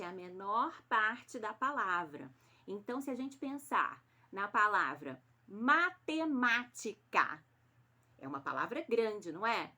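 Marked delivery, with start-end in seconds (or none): 5.5-7.4 "matemática" slow, in separated beats; syllable by syllable. Shown as a demonstration. Teacher giving pupils an example word, "matemática" said syllable by syllable.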